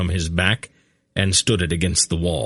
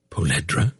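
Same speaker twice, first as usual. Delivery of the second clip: catch in voice; 3 semitones lower than usual. A narrator's reading. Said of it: "with a little catch in his voice".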